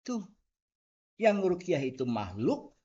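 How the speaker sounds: ordinary delivery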